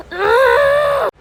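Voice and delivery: high wailing sob; a burst of crying after her clothes are ripped; acted sob. Girl ripped of her clothes in front of everyone lets out a sob.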